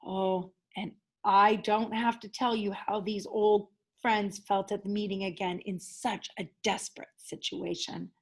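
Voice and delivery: ordinary delivery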